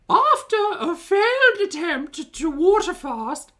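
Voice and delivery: high, loud, slow sing-song vlogger; Foodie Beauty voice. Retold or imitated. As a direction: announce it like a chirpy YouTube intro. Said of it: "my foodie voice".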